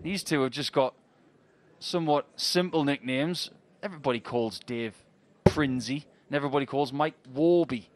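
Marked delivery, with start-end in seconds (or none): none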